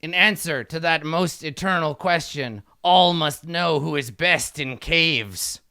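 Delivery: slow, grand ritual question; imperious kobold king. Acted. Kobold king demands sacred words.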